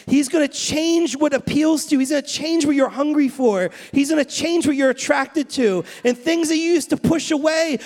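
fervent preacher, pressing the point home; preaching at full force. Acted. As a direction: build the call to the congregation, full voice. Preaching climax.

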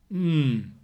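savouring mmm with a full mouth. A narrator's reading. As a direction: hum it through a mouthful of food. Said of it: "with his mouth full of bacon and bread and sauce".

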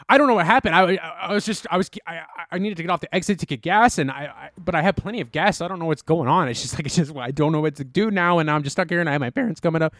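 high, fast, stammering panic; flustered plea. Retold or imitated. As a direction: babble like you're scared and stuck. Panicked retelling of own plea.

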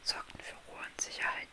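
soft unvoiced bedtime-story whisper; hushed fairy-tale narration; whispered storytelling. A narrator's reading. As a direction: whisper the tale close to the listener.

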